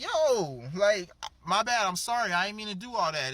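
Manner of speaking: high, loud mock backpedaling; acted hasty apology. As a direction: play the wolf suddenly saying sorry. Wolf apologizes to the brother.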